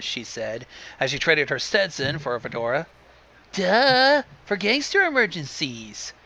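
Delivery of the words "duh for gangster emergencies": exasperated, pitched-up scorn; eye-rolling duh. A narrator's reading. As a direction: say it like it's painfully obvious. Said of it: "she said, rolling her eyes".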